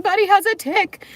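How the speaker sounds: high, loud wailing imitation of herself; imitating hysterical crying. Woman imitating calling her dad about the dog's tick, mock wail. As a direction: replay the panicked sob to her dad. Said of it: "like, hysterically crying".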